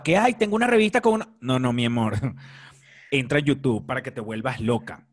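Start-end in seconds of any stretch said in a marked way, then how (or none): 0.2-1.4 high, fast teasing imitation; mocking. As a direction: mimic her naive excitement. Mocking friend's naive thought.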